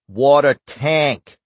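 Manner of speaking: put-on drawn-out Boston accent on "Water tank"; slow, heavy Boston drawl. A narrator's reading. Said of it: "drawing out the words in his heavy Boston accent".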